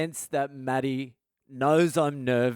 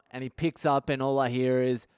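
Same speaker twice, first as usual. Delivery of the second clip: ordinary delivery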